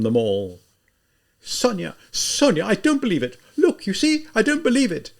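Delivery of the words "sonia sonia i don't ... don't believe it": higher, pleading cry of disbelief. Acted. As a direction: refuse to believe it, voice climbing. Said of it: "Katerina Ivanovna cried".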